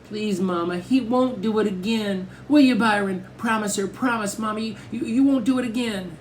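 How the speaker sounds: put-on high, begging little-girl voice; tearful pleading. A narrator's reading. Little sister begs mother to spare her brother, tearfully. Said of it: "The tears really started coming".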